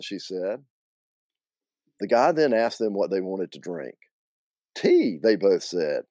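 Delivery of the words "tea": high-pitched Southern ladies; piping up high; Aunt Hallie and Linda. Acted. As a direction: play both aunts, pitched way up. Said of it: "do my best Aunt Hallie and Linda".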